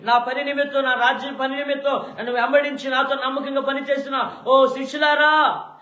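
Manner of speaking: high, loud fervent Lord, pleading; Jesus calls out to his disciples; Jesus comforting disciples. Acted. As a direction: urge the beloved disciples not to fear.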